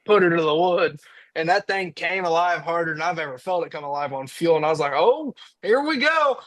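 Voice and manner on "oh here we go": higher and slower, stretched out with thrill; excited here we go. Retold or imitated. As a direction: ride the surge of power, drawn out and high. Truck suddenly comes alive.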